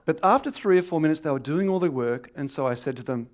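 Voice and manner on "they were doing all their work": ordinary talk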